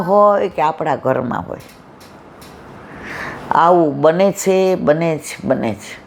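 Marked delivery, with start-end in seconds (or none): 4.7-6.0 softly echoed refrain trailing off; fading echo. Shown as a demonstration. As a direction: let the refrain fade to almost nothing.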